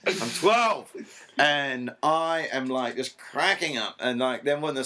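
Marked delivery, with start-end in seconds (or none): 0.1-0.9 higher, louder mock kid voice laced with laughter; mimicking the son. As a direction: parrot the kid's catchphrase back through giggles. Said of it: "I went".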